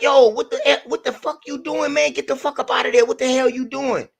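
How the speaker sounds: a light imitation of a stranger scolding his partner; other guy scolding. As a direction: voice the buddy hissing at the thief. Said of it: "I hear the other dude telling him".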